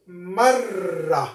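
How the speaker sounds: marra with a held, doubled rr. Shown as a demonstration. Teacher showing students the doubled r, held rr. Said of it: "Notice what is the pronunciation will be".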